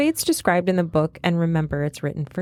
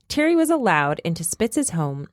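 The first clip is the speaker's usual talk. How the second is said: ordinary delivery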